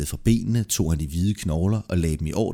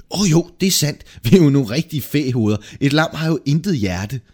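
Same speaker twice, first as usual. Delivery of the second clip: loud, high, sudden mock discovery; emphatic bluffing, pitched up; feigned realisation; 6 semitones higher, 6 dB louder than usual. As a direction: exclaim it as if it just dawned on you. Feigned sudden realisation to cover a lie.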